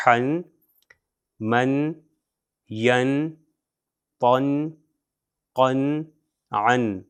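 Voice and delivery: حن من ین پن قن عن, each said as a separate "-un" syllable; one syllable at a time, clear n ending; teacher drilling tanween syllables. Shown as a demonstration. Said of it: "میں ان کو باری باری پروناؤنس کرتا ہوں".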